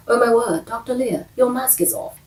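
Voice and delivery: playful imitation of a British doctor's shocked line; British accent. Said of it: "He's not British, but she is".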